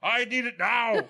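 high, slow whiny demand through a laugh. Pretend. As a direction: insist on it like a brat. Customer demanding nukes, laughing.

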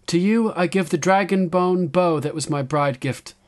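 high, loud address to her people; young queen's proclamation. A narrator's reading. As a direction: proclaim it to a whole crowd.

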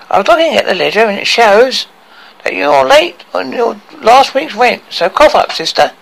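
lightly voiced smug dwarf; collect rent smugly; smug dwarf landlord. A narrator's reading.

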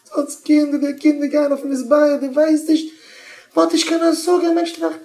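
very high, loud wail; crying complaint. Acted. Mother weeping about the children, hammed-up crying complaint. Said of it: "Und ich weine".

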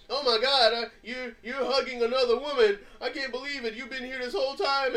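half-hearted angry wife; mock angry wife. Retelling the movie wife scene, mild mock anger. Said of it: "she all mad as fire".

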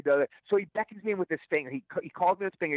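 ordinary delivery

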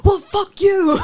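high, loud mock British yell; imitated shouting. Mimicking a father-daughter shouting match, mock shout. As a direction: throw it out like a family row, hammed-up. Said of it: "the shouting match with his daughter".

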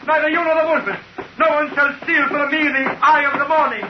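high, wild accusing shouts; frenzied ranting. Acted. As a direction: rave like a man gone mad. Simon raves about his secret.

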